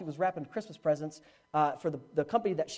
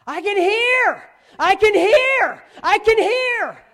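very high, slow, full-force holler; ecstatic yelling; 13 semitones higher, 12 dB louder, much slower than usual. Retold or imitated. Healed girl yelling to the youth group, ecstatic shout. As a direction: yell each one out long and high. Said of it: "she's yelling".

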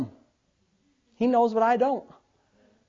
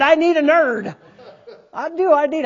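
higher, louder, laughing delight; excited praise; 4 semitones higher, 5 dB louder than usual. Retold or imitated. To the congregation, laughing excited praise. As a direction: crow with joy over your nerd. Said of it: "I said".

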